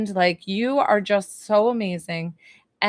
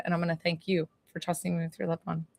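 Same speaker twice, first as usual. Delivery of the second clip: usual speaking voice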